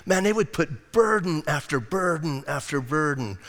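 ordinary delivery

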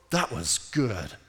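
low, soft, slow awe; awed appreciation. Acted. Longtime Bible reader savours a verse, low and awed. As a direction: drop down and savour each word.

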